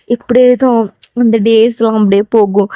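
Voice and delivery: ordinary delivery, nothing marked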